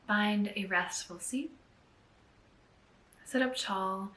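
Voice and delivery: unhurried, hushed meditation lead; guided meditation. Acted. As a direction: gently guide them inward, leaving space between phrases. Leads a guided gratitude meditation.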